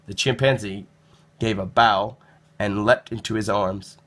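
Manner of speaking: ordinary delivery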